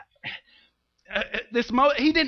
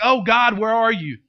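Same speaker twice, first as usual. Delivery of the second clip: louder, higher anguished call; desperate cry to God; 4 semitones higher, 5 dB louder than usual. Retold or imitated. Preacher voicing a lost believer, desperate and loud. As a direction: plead up to heaven in despair. Caught again, crying to God.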